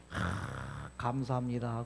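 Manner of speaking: low, soft sigh of gratitude; gentle thanks. Shown as a demonstration. Lecturer thanking the body's water, low and soft. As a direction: breathe out, then thank softly. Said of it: "아까처럼 이렇게".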